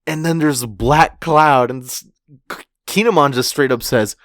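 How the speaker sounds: ordinary delivery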